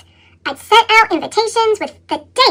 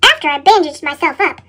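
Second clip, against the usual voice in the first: ordinary delivery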